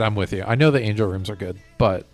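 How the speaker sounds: ordinary delivery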